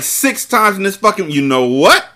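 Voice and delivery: ordinary talk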